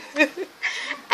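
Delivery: high breathy laugh; giggling burst. Real, not acted. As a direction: let out a high, airy laugh. Laughing after ta-da.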